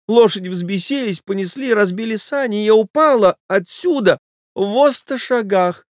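high, breathless society lady voice; a frightened wife begging him off; flustered lady. Acted. Wife pleading with her lover to leave, high flustered voice. Lady's voice in the story.

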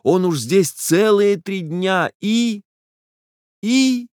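high, slow, trembling heroine's voice; agitated young woman. Acted. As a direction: let her voice climb toward tears. Nastenka's voice, rising agitation.

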